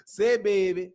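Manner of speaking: quick New Orleans catchphrase imitation; New Orleans accent. Streamer repeats a phrase from his old city, New Orleans accent. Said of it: "I love the accent".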